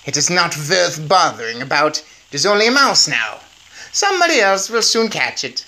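high, commanding witch voice rising to a screech; Grand High Witch shouting orders, high and scornful. Acted. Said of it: "the Grand High Witch was shouting".

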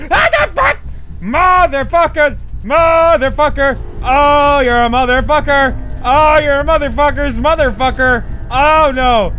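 high, loud swearing outburst; startled, angry yelling. Real, not acted. Player ambushed at the door by the enemy, startled angry yelling. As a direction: jolt into a yell, then keep cursing loudly.

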